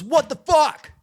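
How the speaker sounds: high, loud, indignant yell; acted irate demand from an annoyed worker; angry outburst. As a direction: bark it in high-pitched outrage. Acted angry gas station guy.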